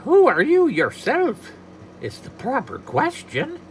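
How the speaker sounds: high, slow, prim leprechaun. Acted. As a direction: put a fussy little leprechaun on it. Leprechaun Patrick Begorra voiced.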